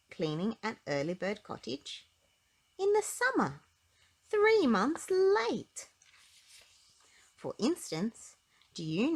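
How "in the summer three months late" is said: comic punchline. A narrator's reading. Comic punchline of late dates.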